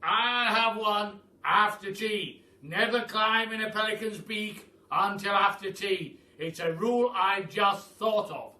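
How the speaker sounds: put-on old Duke. A narrator's reading. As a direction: hail them like a grand old duke. Duke voiced in the story.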